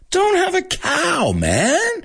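very high, drawn-out cartoon kid; cartoon catchphrase voice. Host delivering his cowbell catchphrase, high cartoon kid. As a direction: stretch it out high like a cartoon brat. Performs Bart's catchphrase again.

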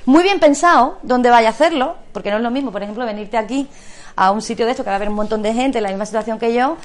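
ordinary talk

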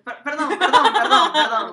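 loud, high, laughing through it; laughing apology. Real, not acted. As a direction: apologize while cracking up. Apologizes while laughing at herself. (disculpándose entre carcajadas)

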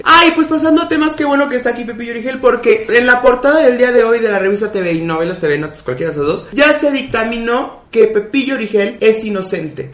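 high, loud gushing TV host; gushing show reporter. Retold or imitated. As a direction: announce the big news like a thrilled gossip reporter. Imitates gossip show reporter. (reportero de chismes, agudo y fuerte)

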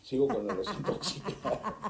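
high, loud burst through real laughter; laughing while trying to get back on track. Real, not acted. Guest resumes after forgetting the point, laughing.

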